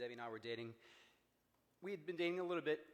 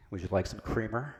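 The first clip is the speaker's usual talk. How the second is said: booming, much louder and lower; big booming voice; 4 semitones lower, 12 dB louder than usual. Retold or imitated. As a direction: boom it out so the floor shakes. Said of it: "In the big booming voice".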